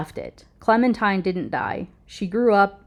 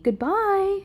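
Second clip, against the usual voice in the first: put-on mother waving off her children, cheerful and high; loving call; 13 semitones higher than usual. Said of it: "I shout lovingly".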